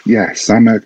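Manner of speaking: ordinary talk, nothing marked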